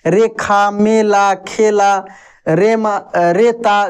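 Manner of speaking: slow, drawn-out syllables रेमा, रेता; relaxed slow demonstration; slow demo. Shown as a demonstration. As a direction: stretch रेमा and रेता out calmly. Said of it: "आराम से बोला जाता है".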